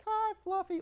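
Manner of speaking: high, sweet greeting to the spider; baby talk to pet. Retold or imitated. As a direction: coo at the tarantula up high.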